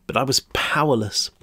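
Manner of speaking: usual speaking voice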